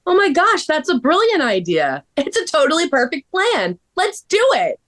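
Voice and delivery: very high, gushing excitement; thrilled girlfriend. Acted. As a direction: squeal with delight at the idea. Elle thrilled by gift idea.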